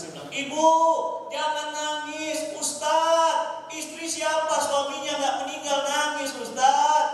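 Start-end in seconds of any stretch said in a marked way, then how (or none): 0.3-2.5 high, loud retold appeal; calling out consolation. Ustaz tells the weeping wife to stop, loud drawn-out appeal. 2.6-7.0 high, loud mock wail; imitated crying wife. Newly widowed wife protests to the ustaz, imitated tearful wail. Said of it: "Wah, istrinya nangis, Pak. Nggak berhenti-berhenti nangis".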